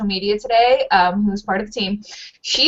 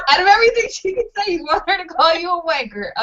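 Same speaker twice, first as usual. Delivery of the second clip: incredulous; 8 semitones higher than usual. Retold or imitated. Mock disbelief at husband.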